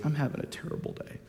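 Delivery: whispered and fast; tired agent. Retold or imitated. Worn-out counter agent telling him her bad day, whispered. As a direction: breathe it out as a weary whisper. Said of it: "She starts crying".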